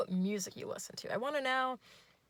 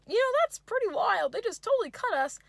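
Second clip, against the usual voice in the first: much higher, louder chirpy okay; high yay voice; 5 dB louder than usual. Retold or imitated. Date agrees in a high goofy chirp. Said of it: "He goes".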